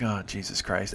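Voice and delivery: soft, low groan of exasperation; quiet fed-up curse to himself; exasperated mutter. Retold or imitated. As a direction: sigh it out under your breath. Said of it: "and I'm like".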